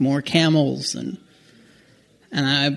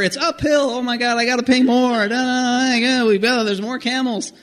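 mock tourist chatter; 8 semitones higher than usual. Mocking his own tourist thoughts.

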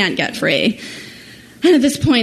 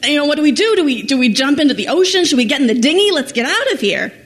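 frantic questions; 6 semitones higher, faster than usual. Retold or imitated. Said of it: "I grab the life jackets and I say".

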